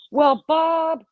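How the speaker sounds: put-on "Well, Bob" way up high and loud like a crying toddler. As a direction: whine "Well, Bob" like a toddler about to cry. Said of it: "like a toddler".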